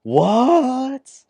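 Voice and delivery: very high, loud cry of disbelief; shocked. Acted. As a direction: blurt it out in total shock. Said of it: "he's just shocked".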